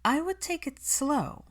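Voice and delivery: slow, firm advice; pointed suggestion. Acted. Hermione pointedly steering Catherine, slow and firm. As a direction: steer her off the plan. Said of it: "It wasn't a suggestion".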